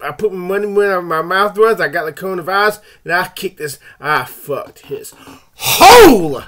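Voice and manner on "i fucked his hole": loud, slow, drawn-out boast; slow bragging shout. Real, not acted. Man boasting about beating covid, slow loud shout. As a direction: bellow each word like a victory roar.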